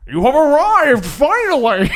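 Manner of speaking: loud, booming villain greeting; villain Bane. Acted. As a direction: announce it like a gloating villain.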